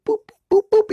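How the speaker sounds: high-pitched spaced-out boops; imitated boops. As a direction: pop out little boops, one by one. Mimes cones being spaced out.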